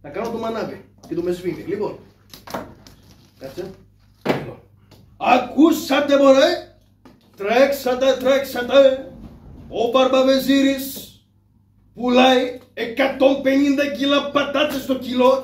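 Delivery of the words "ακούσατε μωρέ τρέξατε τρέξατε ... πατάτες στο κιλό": loud, high, slow crier's shout; a slow, drawn-out crier's announcement; town crier call. Acted. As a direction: bellow the news across the market. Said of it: "εγώ έχω χαϊδροφωνάρα".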